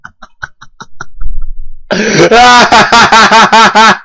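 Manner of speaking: high hearty laugh; burst of laughter. Real, not acted. Laughing after death.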